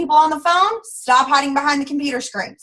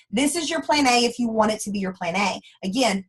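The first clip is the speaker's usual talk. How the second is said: ordinary delivery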